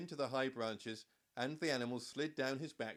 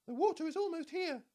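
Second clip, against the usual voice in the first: very high, squeaky and a bit louder; squeaky giraffe; 7 dB louder than usual. A narrator's reading. Giraffe warning the animals, high and squeaky. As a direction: squeak it out like an alarmed little giraffe. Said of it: "he squeaked in his high voice".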